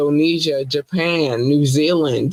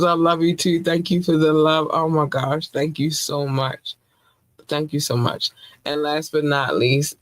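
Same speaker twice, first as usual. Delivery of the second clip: ordinary talk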